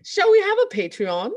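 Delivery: high, loud mock English accent; put-on English accent. Host pitching a Patreon to co-hosts, in mock English accent. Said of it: "having different accents".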